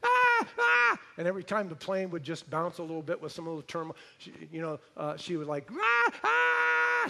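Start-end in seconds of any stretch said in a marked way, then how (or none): 0.0-1.0 piercing high screams of a panicked flyer; shrill hammed-up airplane screams; imitated terrified screams. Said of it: "scared to death". 5.7-7.1 piercing high screams of a panicked flyer; shrill hammed-up airplane screams; imitated terrified screams. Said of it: "She'd scream".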